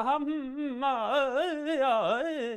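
high, singsong humming whine; drawn-out, high-pitched wordless drone; wordless voice mimicry. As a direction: hum a mock voice with no words, way up high. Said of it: "if somebody sounds like this".

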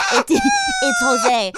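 janitor voice. Retold or imitated.

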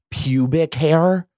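PUBIC HAIR pushed up high in revulsion; disgusted emphasis. Shown as a demonstration. Reader showing listeners why she hates the word, disgusted emphasis on "pubic hair". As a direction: say "pubic hair" with rising, exaggerated distaste. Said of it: "I fucking hate the word pube".

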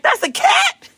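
very high squeaked question; mock disbelief. Frightened guest learns it is a cat, squeaky disbelief. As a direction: squeak it in hammed-up astonishment. Retold disbelief at guide's answer.

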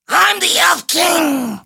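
high, slow squeaky shout; shrill little elf king; Percy Helton voice. Retold or imitated. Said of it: "who sounds like Percy Helton".

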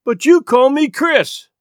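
put-on a hurt boy objecting; wounded, reproachful plea. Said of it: "the boy expostulated reproachfully".

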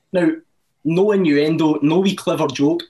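usual speaking voice

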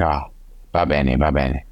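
lightly imitated Italian, pitched low; Italian accent. Rider imitating Diego's reply, light Italian accent. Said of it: "that's my Italian accent".

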